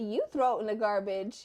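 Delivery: imitated defiant child. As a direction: mimic a son talking back. Imitating son talking back.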